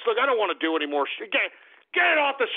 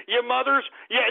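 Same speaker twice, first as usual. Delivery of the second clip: ordinary delivery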